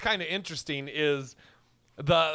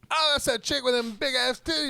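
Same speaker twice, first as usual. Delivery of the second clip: high, louder leering priest voice; mock priest; 10 semitones higher, 3 dB louder than usual. Mocking the priest who eyes a cam girl, high and loud. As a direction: play the priest as a goofy leering creep. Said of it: "the father is like".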